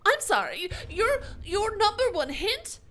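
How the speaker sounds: loud, high, hammed-up sarcastic rant; sarcastic mock outrage. Streamer mocking a viewer's "get good" advice with loud sarcastic outrage.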